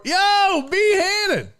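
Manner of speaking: big thank-you for the donation; hype shout-out. Real, not acted. Thanking a donor on stream.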